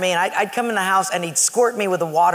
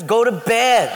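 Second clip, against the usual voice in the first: higher, louder mock yell; exasperated order; 4 semitones higher, 4 dB louder, slower than usual. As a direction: send the pest to bed, losing patience. Second, escalated refusal to roommate.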